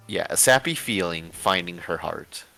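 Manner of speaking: ordinary talk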